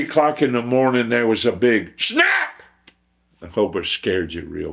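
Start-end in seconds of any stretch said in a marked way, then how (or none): 2.0-2.6 explosive, high shouted SNAP; sudden startling snap. Acted. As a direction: shout SNAP suddenly to make listeners jump. Imitates trap going off to startle.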